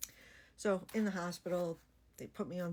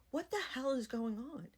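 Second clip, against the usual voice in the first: alarmed disbelief; 7 semitones higher than usual. Retold or imitated. Patient to the crowd of medics, high alarmed disbelief. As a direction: pitch jumps up in shocked confusion. Swarmed by staff before surgery.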